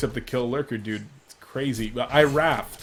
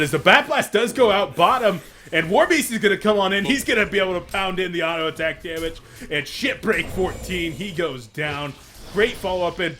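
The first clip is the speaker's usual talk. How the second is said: louder, higher game commentary; play-by-play; 5 semitones higher, 5 dB louder than usual. Real, not acted. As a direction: launch into calling the attack.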